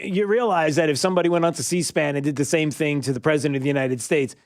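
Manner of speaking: ordinary delivery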